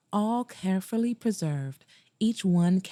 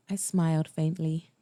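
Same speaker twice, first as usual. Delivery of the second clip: usual speaking voice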